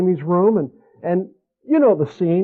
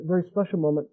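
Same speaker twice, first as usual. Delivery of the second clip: normal talking voice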